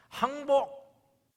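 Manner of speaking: 항복 stretched very long and loud; drawn-out stress. Acted. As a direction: lean hard on 항복. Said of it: "항복선을 하는 겁니다". (항복을 길게 강조)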